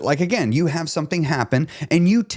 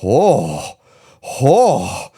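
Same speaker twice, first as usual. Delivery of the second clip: loud sighing oh, lower; real exhale after the rant; winding down; 4 semitones lower, 4 dB louder than usual. Real, not acted. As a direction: shake off the rant with a loud breath out. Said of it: "I gotta bring myself down after talking like that".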